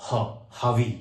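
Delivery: ह then हावी, the R breathed as ह; French R as ह. Shown as a demonstration. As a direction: break हावी into its breathy ह start. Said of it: "हम इसको आर को". (आर को ह बोलकर हावी)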